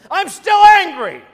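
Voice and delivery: hammed-up angry yell about the bike; mock furious shout. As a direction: yell it in fake fury. Joking rage over stolen bike.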